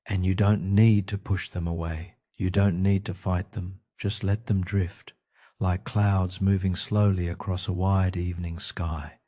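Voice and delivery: ordinary talk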